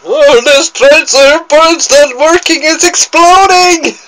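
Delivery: hammed-up high panic voice; high excited guy. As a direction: squeal it like a panicking guy, slow. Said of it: "the guy's going".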